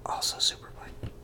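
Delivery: whispered, very small add-on; hushed little aside; meek afterthought. Retold or imitated. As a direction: murmur it as a sheepish footnote. Meek add-on after the battle cry.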